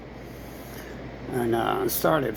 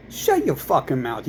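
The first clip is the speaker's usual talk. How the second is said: ordinary talk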